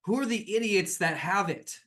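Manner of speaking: angry demand. Acted. NPC demands angrily.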